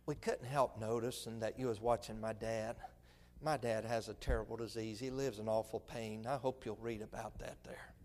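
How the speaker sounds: low, hushed, kindly explanation; gentle, tactful appeal. Acted. Son explaining dad's illness to onlookers at the restaurant, gently. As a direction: break it to them softly, without reproach. Models his family's gentle tract speech.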